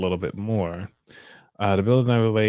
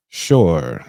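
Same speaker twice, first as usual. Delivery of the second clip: low, louder receptionist Sure; deep voice guy; 5 semitones lower, 6 dB louder than usual. Acted. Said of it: "I can get a deep voice guy for the receptionist".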